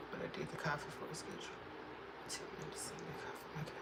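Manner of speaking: soft unvoiced whisper; hushed aside. Real, not acted. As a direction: whisper it off mic.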